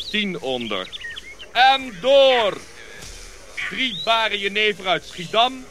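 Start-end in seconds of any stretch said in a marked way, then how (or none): 1.5-2.6 loud call to move on; a commanding call down the line. Acted. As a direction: shout the bearers forward. Calling the procession onward.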